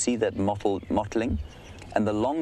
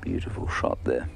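hushed; 6 semitones lower than usual. Real, not acted. Quiet remark on the camera shot.

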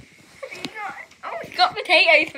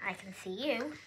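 whispered, teasing the hiding pet; playful whisper; 5 semitones lower, 14 dB quieter than usual. Real, not acted. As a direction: hush it like a peekaboo game. Playful hiding game with hamster.

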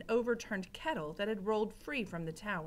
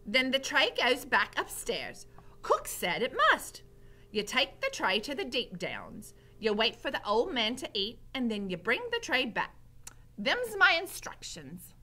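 Mig; 4 semitones higher, 5 dB louder than usual. A narrator's reading. Mig to Gregory about the tray, high emphatic voice. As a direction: recite orders loudly in a high voice. Mig recites her orders.

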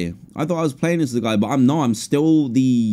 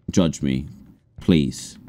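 ordinary delivery

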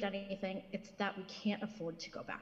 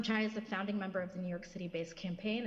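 ordinary talk

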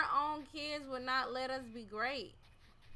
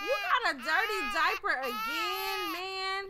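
loud, higher exasperated outburst at the screen; exasperated shout; 6 semitones higher, 8 dB louder than usual. Real, not acted. Gamer yelling at the crying baby, exasperated. Exasperated at game baby's diaper.

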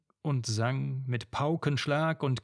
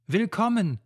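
higher, louder call of welcome; joyful welcome cry; 7 semitones higher, 5 dB louder than usual. Acted. A bride calls welcome to the returning army, higher and louder. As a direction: hail your returning groom. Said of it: "rief Kind und Gattin laut".

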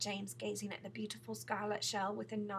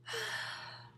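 long, audible acted sigh; 13 semitones higher than usual. A narrator's reading. Miss Spider answers James, sighing long and loud. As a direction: let out a drawn-out breath. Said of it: "sighing long and loud".

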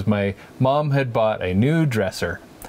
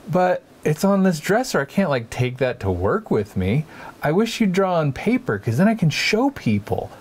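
high, sweet, encouraging mother voice; mom's voice; 7 semitones higher than usual. Retold or imitated. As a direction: gently coax a child like a doting mom. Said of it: "my mom said".

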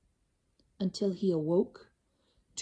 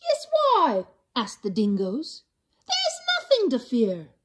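high, encouraging creature voice; coaxing dingoes; 4 semitones higher than usual. Acted. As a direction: coax him down gently. Dingoes coax Kevin.

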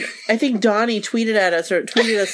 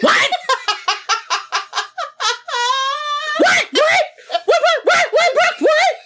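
frantic what; much slower than usual. Retold or imitated. Imitating [name] hearing [name] is here, high laughing squeal. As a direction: squeal it like you just heard the crush is in the room. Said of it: "That's what you would sound like".

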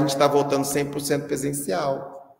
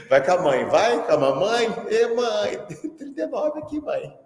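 calling mother; 6 semitones higher, slower than usual. Pretend. Calling mom to take child.

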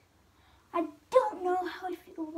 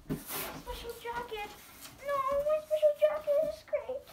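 whiny voice; 13 semitones higher than usual. Acted. Mourning his ruined jacket.

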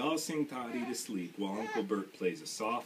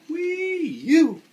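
squealed much higher and louder, hammed-up yuck; mock disgust; 14 dB louder than usual. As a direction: shriek it like a stinky diaper. Diaper change, mock disgust.

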